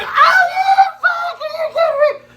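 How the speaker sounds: shrieking high and loud, laughing through it; retold outraged yell. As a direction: yell it in disbelief, half laughing.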